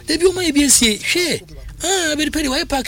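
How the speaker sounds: hammed-up mimicry of a football boss; mimicking David Park. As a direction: squeak it out as a mocking impression. Host mimics David Park's voice.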